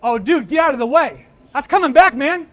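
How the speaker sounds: loud, high warning to a buddy; hammed-up bystander panic; excited alarm. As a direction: play a spectator yelling at an impending hit. Acted reaction to science demo video.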